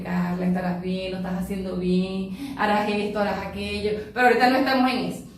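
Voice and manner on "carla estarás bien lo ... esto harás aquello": low, soft murmured self-reassurance; introspective self-talk. Pretend. Teacher reassuring herself in a moment of introspection, low quiet self-talk. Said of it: "un caso de introspección, de esos momentos como que".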